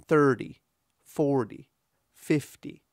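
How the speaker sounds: THIR-ty, FOR-ty, FIF-ty, each falling away; "thirty", "forty", "fifty" front-stressed, separately; first syllable stressed. Shown as a demonstration. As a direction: punch the start of "thirty", "forty", "fifty", light "-ty". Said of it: "the first syllable is stressed".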